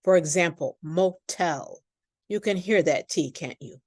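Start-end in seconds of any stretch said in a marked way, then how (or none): 0.8-1.8 motel with a strong, popped aspirated T. Shown as a demonstration. Teacher shows the stressed-syllable exception: strong popped T. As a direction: pop the T in motel hard. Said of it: "we do say a strong popped T".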